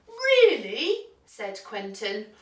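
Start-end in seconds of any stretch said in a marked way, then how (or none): 0.1-1.1 high, loud, swooping question; surprised duck. A narrator's reading. Duck hearing the dog's offer, surprised high voice. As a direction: squeak it out in disbelief.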